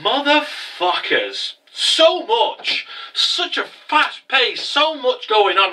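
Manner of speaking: drawn-out, slow exhausted marvelling; overwhelmed. Retold or imitated. Fan halfway through the episode, exhausted and overwhelmed. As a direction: stretch each phrase, worn out and amazed. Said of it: "I was exhausted".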